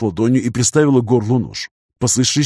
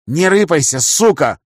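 hoarse threat; 5 semitones higher, 3 dB louder, slower than usual. A narrator's reading. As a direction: growl it hoarsely with menace. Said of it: "хриплым голосом сказала Алиса".

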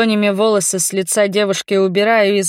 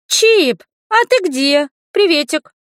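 put-on very high, bright-pitched kid's voice; voiced young girl; 9 semitones higher, 4 dB louder than usual. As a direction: greet dad as a little girl on the phone. Voices child Ru on phone. (высокий детский голосок)

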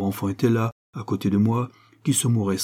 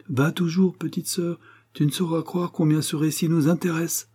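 higher-pitched, sly encouragement; teasing sister; 7 semitones higher than usual. Acted. Elder sister egging on the storyteller, teasing. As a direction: urge her on with mock innocence.

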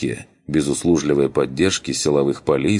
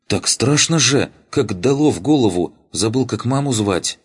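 high, breathless frightened voice; scared boy; 7 semitones higher than usual. Acted. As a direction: blurt it out still shaken. Frightened boy after the sonic blast.

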